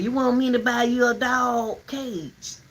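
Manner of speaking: imitating the man. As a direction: mimic his question, drawn out. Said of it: "And he said".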